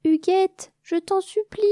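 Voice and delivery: high, pleading cry into the dark; desperate plea. Acted. The abandoned little queen crying after her cousin, begging desperately. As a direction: beg her not to leave. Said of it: "lui cria la petite reine".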